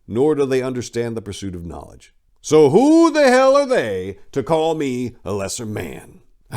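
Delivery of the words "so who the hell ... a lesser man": loud, slow, higher indignant demand; defiant challenge. Real, not acted. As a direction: throw the question back at his critics.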